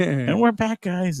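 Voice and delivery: very high mock host voice; high squeaky voice. Mock announcer intro.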